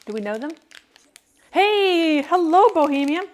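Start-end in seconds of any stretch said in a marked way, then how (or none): 1.5-3.2 high, loud bright hello; cheerful greeting. Real, not acted. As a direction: sing out a hello to a viewer. Shout-out greeting to a viewer.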